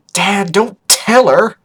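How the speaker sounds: high, loud, drawn-out plea; embarrassed protest. Acted. Vinyl begging Dad not to tell, mortified protest. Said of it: "ready to die from embarrassment".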